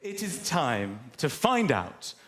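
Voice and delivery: slow, dramatic presenter reveal; show host announcement. Acted. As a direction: build suspense like a TV host.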